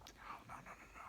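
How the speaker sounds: breathy horrified whispered noes. Retold or imitated. Refusing her again, a horrified whisper.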